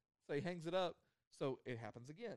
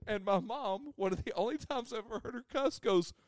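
ordinary talk, nothing marked

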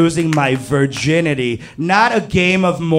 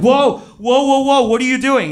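alarmed protest; 9 semitones higher, 3 dB louder than usual. Retold or imitated. Sudden alarm at her move.

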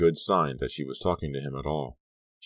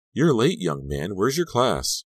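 raised, louder voice of reprimand; scolding teacher; 6 semitones higher, 5 dB louder, slower than usual. A narrator's reading. Teacher at the door to a late student, scolding. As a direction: call a latecomer to account.

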